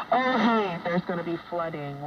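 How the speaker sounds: ordinary talk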